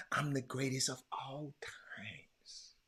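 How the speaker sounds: slow hushed reverent whisper; Muhammad Ali quote. Retold or imitated. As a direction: breathe the line like a legend's motto. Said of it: "Muhammad Ali even put an S on it".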